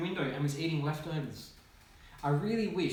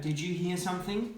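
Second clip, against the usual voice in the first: plain reading voice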